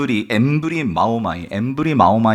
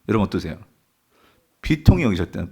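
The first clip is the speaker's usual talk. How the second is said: normal talking voice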